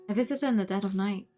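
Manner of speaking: ordinary talk, nothing marked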